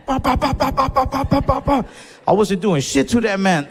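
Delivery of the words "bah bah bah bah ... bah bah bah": high-pitched rapid-fire "bah" punch noises; comic high staccato fight sound effects; imitated rapid punches. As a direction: mime a flurry of fast swings by voice. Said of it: "I started swinging as fast as I can".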